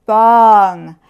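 loud, drawn-out impact word; booming thud. A narrator's reading. Coconut lands on sleeping lion, booming bong. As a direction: boom it out like a heavy hit.

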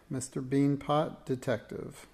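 plain reading voice

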